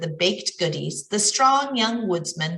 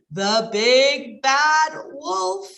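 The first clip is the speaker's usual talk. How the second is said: slow, high, dramatic reveal of the big bad wolf; menacing wolf reveal; 6 semitones higher, 3 dB louder, much slower than usual. A narrator's reading. Storyteller unveils the villain to children, slow and dramatic. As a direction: drag it out like a spooky announcement.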